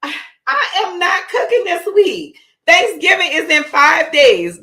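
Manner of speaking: girl in video quoting her mom, mock stern; imitating mama. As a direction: play the fed-up mama. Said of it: "You know how your mama be like".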